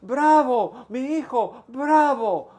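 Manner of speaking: high, loud cheering for her son; yelling again. Acted. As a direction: call out to him as he walks down the steps. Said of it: "she starts yelling".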